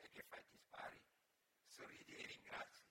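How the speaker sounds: usual speaking voice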